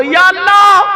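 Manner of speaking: high, loud devotional cry; calling on God. Real, not acted. Leads congregation calling on God. (ऊँची आवाज़ में अल्लाह को पुकार)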